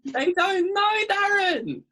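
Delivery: high, loud Essex diva shout; Gemma Collins. Retold or imitated. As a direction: shriek it like a reality TV diva. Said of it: "sounds like Gemma Collins".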